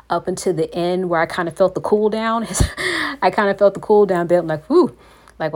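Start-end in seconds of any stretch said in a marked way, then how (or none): 2.5-3.2 short high laugh. Real, not acted. Laughs recalling worship. 4.6-5.0 relieved exhale. Retold or imitated. Relief after intense worship.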